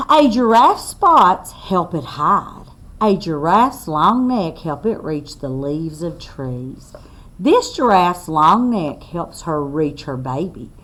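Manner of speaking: slow, loud read-aloud to a class; reading to children. Acted. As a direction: spell it out clearly for little listeners. Reads book text aloud.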